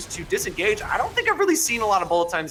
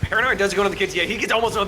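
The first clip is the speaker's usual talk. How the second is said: excited; 5 semitones higher, 4 dB louder, faster than usual. Real, not acted. Caster calls a sudden kill.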